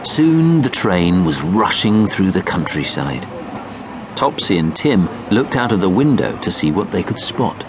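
ordinary delivery

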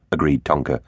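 ordinary delivery, nothing marked

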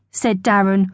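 usual speaking voice

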